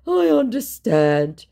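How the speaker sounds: slow, high meek whine; mock his meekness; imitating a meek scammer; meek mock reply.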